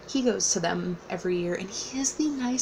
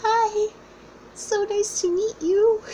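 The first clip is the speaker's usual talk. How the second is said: much higher, drawn-out, giddy hello; starstruck fan; 13 semitones higher, 4 dB louder, slower than usual. Retold or imitated. Nervous fan meeting the famous author, a high giddy hello. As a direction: squeak it out, overwhelmed by a hero. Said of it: "And I was just like".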